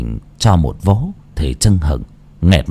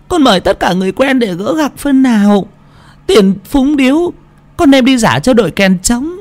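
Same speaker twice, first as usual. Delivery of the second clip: much higher put-on whimpering voice; giả bộ sụt sùi. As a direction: fake a tearful plea to father. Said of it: "giả bộ sụt sùi". (con gái giả vờ sụt sùi, giọng cao the thé)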